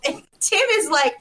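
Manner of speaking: usual speaking voice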